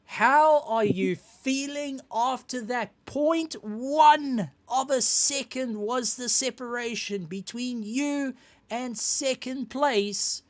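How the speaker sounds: high, excited, slow announcer voice; big hype host introducing the champ. Acted. Host hypes the race winner.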